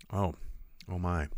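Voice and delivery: ordinary talk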